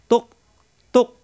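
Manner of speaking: তুক cut hard at the end with no bounce; firm hamza stop. Shown as a demonstration. Teacher drilling hamza for learners, firm unbounced stop in তুক. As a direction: stop the throat firmly on তুক, no echo after. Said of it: "শক্ত হবে কিন্তু বাড়ি খাবে না".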